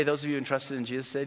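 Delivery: ordinary talk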